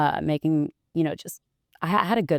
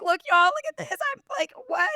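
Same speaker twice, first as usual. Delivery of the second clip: very high, thrilled show-off; high-pitched excited disbelief; excited squeal. Retold or imitated. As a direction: call everyone to look, squealing. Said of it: "I was like".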